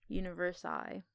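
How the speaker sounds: ordinary talk, nothing marked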